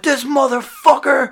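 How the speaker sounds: retold angry outburst. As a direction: spit it out as an imitated furious friend. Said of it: "He's like".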